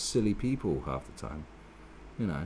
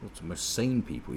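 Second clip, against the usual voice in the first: normal talking voice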